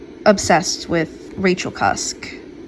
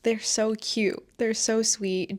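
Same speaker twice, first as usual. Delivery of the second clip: gushing softly; 3 semitones higher, 6 dB quieter than usual. Real, not acted. Gushing over favorite characters.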